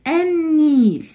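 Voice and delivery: النيل said as ennil, lam not sounded; silent lam. Shown as a demonstration. Teacher to pupils, showing the sun lam in النيل. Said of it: "أنا نطقتها وقلت النيل".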